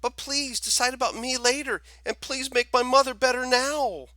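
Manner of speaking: put-on boy begging for his mother; high desperate begging.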